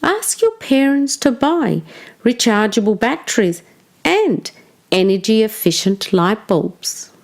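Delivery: ordinary delivery, nothing marked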